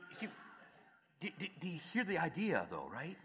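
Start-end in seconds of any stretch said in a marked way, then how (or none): none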